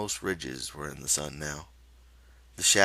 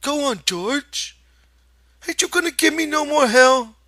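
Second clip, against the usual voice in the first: eager Lenny; 12 dB louder than usual. Acted. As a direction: beg eagerly for the rest. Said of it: "looked eagerly".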